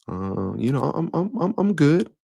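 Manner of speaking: halting, stammering reply that jumps high; a friend covering up, stumbling over the words; unsure stammer with a high-pitched finish; hesitant stutter. Pretend. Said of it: "There's some stuttering".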